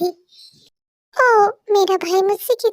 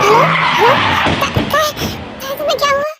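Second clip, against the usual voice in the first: higher, desperate calling of her name; frantic calling; 6 semitones higher, faster than usual. Acted. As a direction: panic as the line goes silent. Call cuts off after crash.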